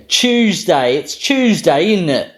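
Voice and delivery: chirpy British meme voice, choosday; British meme accent. Retold or imitated. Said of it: "this meme here".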